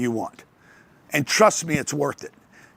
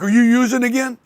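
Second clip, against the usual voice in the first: mom voice; 7 semitones higher, 5 dB louder than usual. Retold or imitated. Mom suspicious on phone.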